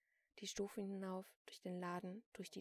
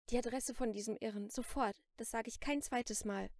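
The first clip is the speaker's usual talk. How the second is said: louder, insistent armed threat; sharp demand; 3 semitones higher, 8 dB louder than usual. A narrator's reading. Celine threatens the shopkeeper at gunpoint, sharp demand. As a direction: press the demand forcefully.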